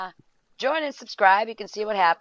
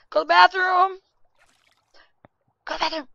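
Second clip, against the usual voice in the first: loud urging; 9 semitones higher, 6 dB louder, faster than usual. Real, not acted. Yelling at game character.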